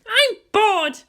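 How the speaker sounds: high, whiny bored groan; drawn-out complaining whine. A narrator's reading. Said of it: "she groaned".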